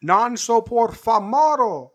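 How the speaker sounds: high, slow Italian home run call; John Sterling home run call. Retold or imitated. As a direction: stretch it out like an old broadcaster. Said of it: "he actually changed it to, like".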